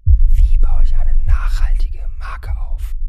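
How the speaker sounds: secretive breathy whisper throughout. Acted. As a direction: whisper each question like a secret. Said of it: "Aber warum flüsterst du denn?".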